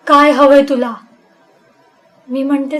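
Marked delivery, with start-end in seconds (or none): 0.1-1.0 loud, scolding question; stern rebuke. Acted. Exorcist sternly asking what she wants, loud scolding. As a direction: snap it at her. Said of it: "तो खडसावून विचारतो".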